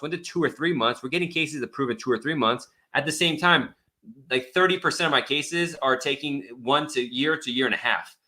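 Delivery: ordinary talk, nothing marked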